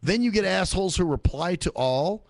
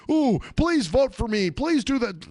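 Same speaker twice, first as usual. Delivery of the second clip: high, slow, louder whiny begging; mock pleading; 9 semitones higher, 4 dB louder, slower than usual. Mocking Facebook spammers begging everyone for votes, high and slow. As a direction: whine it like a needy stranger begging for votes.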